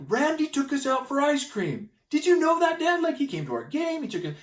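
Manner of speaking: put-on high, bright-eyed boys bursting with news; excited kids. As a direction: voice the boys gushing to their dad. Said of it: "the boys came home and they're like".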